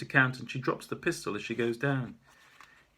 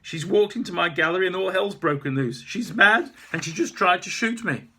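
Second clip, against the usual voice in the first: loud, higher protest; shouting back; 8 semitones higher, 11 dB louder than usual. Acted. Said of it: "I shout back".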